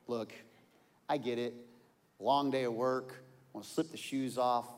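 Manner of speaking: ordinary delivery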